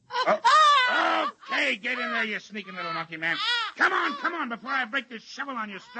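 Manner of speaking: high, loud shouts at a struggling captive; angry shouting. Acted. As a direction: roar at him while shoving him inside. Wrestling captive into tent.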